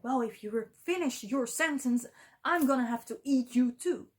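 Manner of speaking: storytelling cat's angry warning. Acted. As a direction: warn the mouse with rising force. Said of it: "the cat is really angry".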